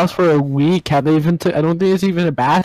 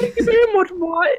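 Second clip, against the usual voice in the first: put-on girl voice. Girl voice thanks.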